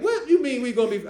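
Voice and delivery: put-on high, loud demanding teen voice; incredulous teen question. Niece demanding what he means, high and loud. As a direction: demand an explanation, baffled.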